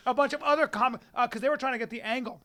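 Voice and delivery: usual speaking voice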